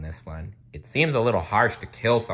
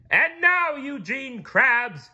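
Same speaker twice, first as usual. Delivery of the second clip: high, loud, slow royal proclamation; slow, forceful shouted decree; booming declaration; 7 dB louder, slower than usual. A narrator's reading. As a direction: declare the sentence like an angry king. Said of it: "he declared".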